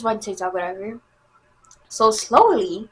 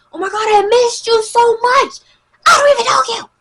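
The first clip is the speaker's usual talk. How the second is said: hammed-up squeal, much higher; mock gushing; 12 semitones higher, 4 dB louder than usual. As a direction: fake an over-the-top reunion shriek. Mock gushing she refuses to do.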